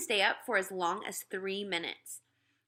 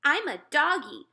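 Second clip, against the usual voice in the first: put-on high, loud little-kid announcement; a toddler pretending to be a dog; playful toddler; 7 semitones higher, 6 dB louder than usual. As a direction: toddler under the table playing dog, gleeful. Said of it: "He said".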